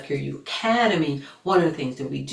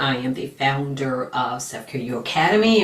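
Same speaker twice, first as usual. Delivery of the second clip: ordinary delivery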